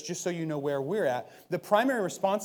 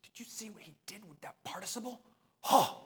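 much softer, hushed fan gossip; mock admiring murmur; 4 semitones higher, 4 dB quieter than usual. Listener leaving church praising the preacher, hushed mock awe. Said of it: "you don't leave here thinking".

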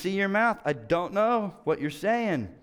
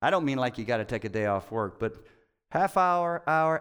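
ordinary delivery

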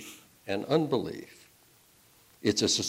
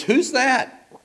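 much higher, loud startled cry; startled question; 8 dB louder than usual. Acted. Dangling man hears a voice from nowhere, startled high cry. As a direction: jump at the unseen voice.

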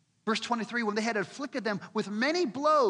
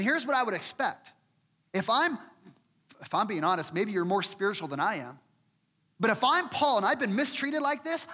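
usual speaking voice

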